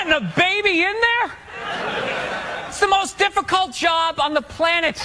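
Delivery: high, sarcastic marvelling; mock awe. Comic mocking mothers' hard work with fake awe. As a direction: gush in fake amazement.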